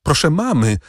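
high urgent call up the stairs; calling for help. Acted. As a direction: summon her stepmother, pleading. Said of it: "zawołała Valentin macochę". (wołanie o pomoc do macochy)

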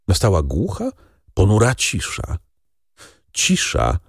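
plain reading voice

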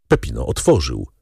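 plain reading voice

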